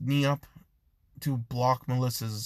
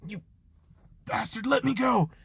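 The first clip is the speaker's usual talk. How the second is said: high, strained demand to be released; angry protest; 9 semitones higher than usual. Acted. Melissa pinned to the wall by Izuku, angry protest. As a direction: protest high, fighting the hold.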